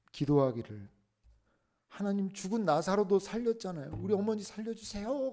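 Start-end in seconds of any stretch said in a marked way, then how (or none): 1.9-5.3 put-on high, pleading child's cry to God; crying plea to God. Boy begging God to revive his mother, high tearful plea. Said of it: "소리를 치면서 막 떼를 썼다는".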